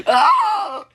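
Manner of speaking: very high, loud shriek; long piercing cartoon scream; outraged scream. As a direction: scream it out in protest. Outraged after being punched.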